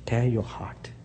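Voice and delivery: hushed unvoiced plea; urgent whisper. Preacher whispering to congregation to tear their hearts. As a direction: whisper it like a secret command.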